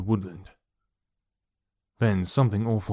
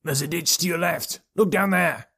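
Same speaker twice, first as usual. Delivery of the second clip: high, distant-sounding call; 7 semitones higher than usual. A narrator's reading. Colleague directs a searcher nearby, calling out. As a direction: call across the woods without full volume. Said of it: "one of his colleagues called out".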